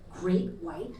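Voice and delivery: low, soft voice of a disapproving daughter; understated, hushed accusation; imitated hushed remark. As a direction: drop it quietly, lightly imitating a child's murmur. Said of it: "because she whispered her shit".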